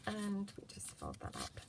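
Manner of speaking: soft working whisper. Real, not acted. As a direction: whisper to herself.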